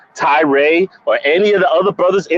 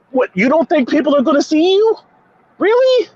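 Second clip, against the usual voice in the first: incredulous; 11 semitones higher than usual. Real, not acted. Incredulous rant at player.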